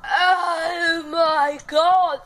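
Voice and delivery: very slow, loud oh my God; drawn-out loud groan. Real, not acted. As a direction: stretch it out in agony. Aftermath of sour lemon.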